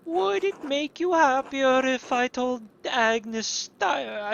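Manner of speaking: high, slow gossiping voice; monk voice. Acted. Monk passing on gossip, high and slow. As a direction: lift the voice high and take it slow. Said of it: "these fucking wild accents".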